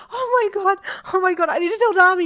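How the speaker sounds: high, loud, ecstatic shrieking; cried out. A narrator's reading. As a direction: cry out overwhelmed with happiness. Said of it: "you cried".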